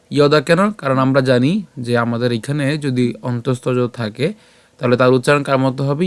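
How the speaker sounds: ordinary talk, nothing marked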